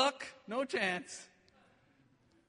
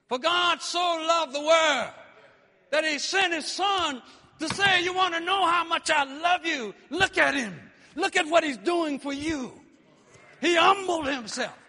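fervent preaching; 5 semitones higher, 6 dB louder than usual. Acted. Rising preaching.

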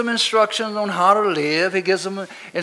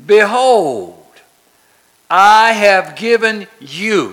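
proclaiming scripture; 8 dB louder, slower than usual. Acted. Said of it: "Look at it again".

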